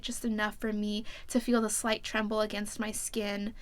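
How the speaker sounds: soft, even reading aloud; story narration. A narrator's reading. Reading the tender scene to viewers, soft narration. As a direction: read the intimate passage quietly and steadily. Reads the book passage aloud.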